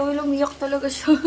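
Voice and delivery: ordinary talk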